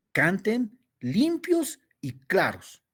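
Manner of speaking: slow, high "Canten limpios y claros"; slow emphatic. Shown as a demonstration. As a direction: spell it out slowly, each word weighted. Cita enfática del versículo. (lento, alto y enfático)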